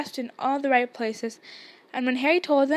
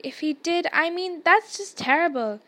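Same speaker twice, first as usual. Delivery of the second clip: higher-pitched, appalled exclamation; mildly aghast outburst; exclaiming; 5 semitones higher, 3 dB louder than usual. A narrator's reading. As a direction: protest in a raised pitch. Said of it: "Hermione exclaimed".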